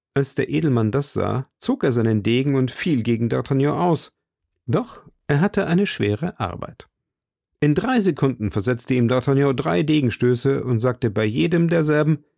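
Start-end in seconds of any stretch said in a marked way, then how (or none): none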